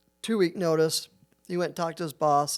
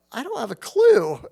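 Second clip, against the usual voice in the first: higher and louder, throwing up his hands; mock exasperated cry; 7 semitones higher, 7 dB louder than usual. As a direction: blurt out that he has no clue. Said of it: "I go".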